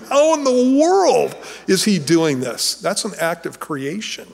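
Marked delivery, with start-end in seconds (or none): 0.1-2.8 amazed disbelief. Retold or imitated. Imagining staring at Jesus' hands.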